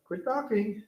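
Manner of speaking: high-pitched annoyed cat; translated cat voice. Retold or imitated. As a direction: brush someone off as a cat. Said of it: "And he's like".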